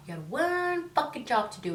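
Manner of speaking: high, loud mock exasperation; exasperated rant. Venting to viewers about husband forgetting trash, exasperated. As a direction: bite down on one job.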